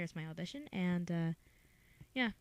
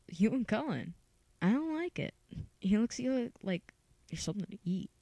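put-on higher-pitched, put-out boy; Mike; 4 semitones higher than usual. Auditioner as Mike grumbling about Cullen, high and put out. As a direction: voice jumps up in acted disapproval. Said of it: "here is Mike".

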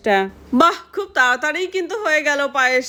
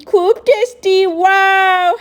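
delighted tasting; 6 semitones higher, 6 dB louder, slower than usual. Acted. Child tasting payesh delighted.

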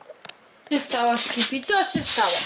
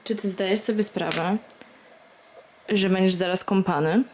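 ordinary talk, nothing marked

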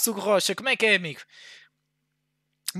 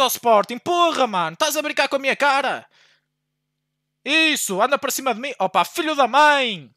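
usual speaking voice

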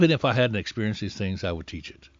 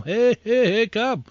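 high, lilting call to the flock; shepherd calling his flock by voice; shepherd calling sheep; 10 semitones higher, slower than usual. Retold or imitated. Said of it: "He calls them".

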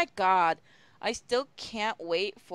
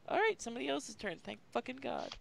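ordinary talk

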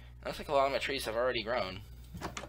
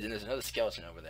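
ordinary delivery

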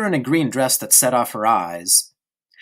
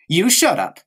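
smug retort; 7 semitones higher, 4 dB louder than usual. Acted. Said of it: "with a broad smile".

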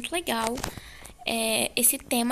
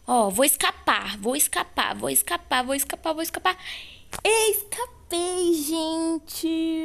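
excited; 5 semitones higher than usual. Real, not acted. Escaping the match.

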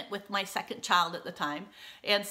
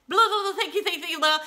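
rushed thanks; 9 semitones higher, 6 dB louder, faster than usual. Retold or imitated. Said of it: "say the little speech as fast as I can".

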